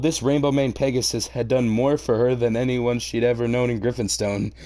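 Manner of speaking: ordinary delivery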